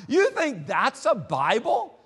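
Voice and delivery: high, loud, slow mocking laugh through words; laughing scornfully. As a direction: scoff at the little Bible while laughing. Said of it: "and he starts laughing".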